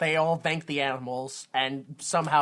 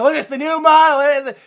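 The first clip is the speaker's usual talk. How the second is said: high, loud announcement; mock unveiling shout; 9 semitones higher, 11 dB louder than usual. Pulling the curtain off the new model, shouted. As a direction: shout it like a big reveal.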